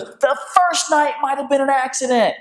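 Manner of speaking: put-on high, indignant little boy's complaint; high, outraged young son; upset boy. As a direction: whine it like a wronged kid. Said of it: "he goes".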